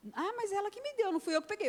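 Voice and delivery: put-on a small girl insisting it's not her fault; protesting little girl. Daughter protesting to mother. (menininha protestando, voz bem aguda e chorosa)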